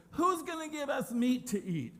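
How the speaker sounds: high, put-on whine; mock complaining. Israelites grumbling over no meat, high mock whine. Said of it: "they'd complained about not getting meat".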